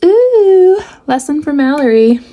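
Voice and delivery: high playful ooh; teasing sing-song. Real, not acted. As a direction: tease yourself in a sing-song.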